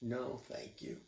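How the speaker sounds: soft, dejected little sigh. A narrator's reading. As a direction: let it out on a sad sigh. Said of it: "sighed Camilla".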